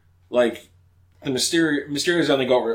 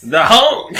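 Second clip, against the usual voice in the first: much higher and louder, hammed-up villain; Kraven voice; 10 semitones higher, 10 dB louder than usual. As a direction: bellow the prey's name triumphantly. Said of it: "that's how he sounds".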